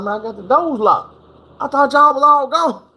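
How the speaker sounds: rising high in defensive panic; defensive protest. Retold or imitated. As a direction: caught in the shop, protesting to the barber, high and rising. Defending himself to the barber.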